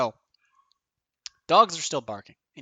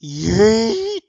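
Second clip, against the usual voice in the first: loud, high drawn-out "Yeet!"; goofy yeet; 7 semitones higher, 9 dB louder than usual. Real, not acted. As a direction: belt out a silly "Yeet!". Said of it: "the stupidest yeet that I've ever spoken".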